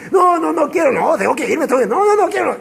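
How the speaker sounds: high, whiny no no no; childish refusal. Acted. Mary clinging to Jesus, refusing to release him, whining. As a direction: plead like a child who won't let go.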